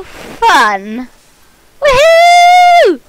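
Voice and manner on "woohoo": high, loud woohoo; whoop. Real, not acted. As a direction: cheer it out. Joy on launch.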